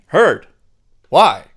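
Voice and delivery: loud, high mock student protest; imagined protesting student. Student challenges teacher about alphabet drill, loud mock protest. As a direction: call out the complaint, high and loud, then rush the question. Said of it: "And you're saying".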